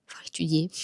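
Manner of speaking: whispered aside. Real, not acted. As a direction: whisper the correction to yourself.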